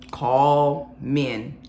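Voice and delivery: ordinary talk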